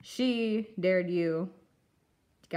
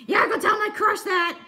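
excited shout; 10 semitones higher, 6 dB louder than usual. Acted. Excited kaiju character calling out.

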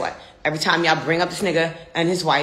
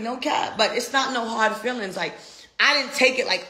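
usual speaking voice

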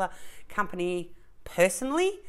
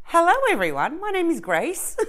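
cheery greeting; 10 semitones higher, 4 dB louder than usual. Real, not acted. Bright vlog intro greeting.

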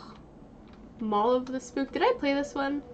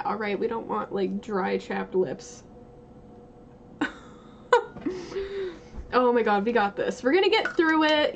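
normal talking voice

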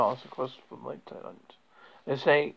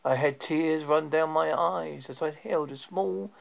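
ordinary delivery

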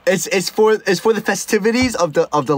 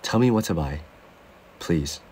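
retold self, lowered; 12 semitones lower, 6 dB quieter than usual. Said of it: "And I go".

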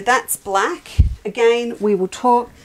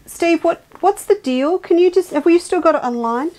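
called out higher and louder across the room; calling out; 8 semitones higher, 5 dB louder than usual. Real, not acted. [name] calling to Steve off-camera, higher and louder. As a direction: raise your voice to reach him in the next room.

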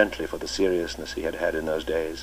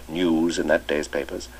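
plain reading voice

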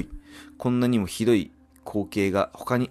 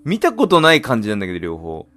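loud baffled question; puzzled; 4 semitones higher, 8 dB louder than usual. Real, not acted. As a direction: ask in loud disbelief. Puzzled by unknown kanji.

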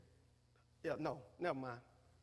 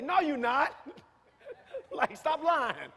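laughing retort; 5 semitones higher, 4 dB louder, slower than usual. Pretend.

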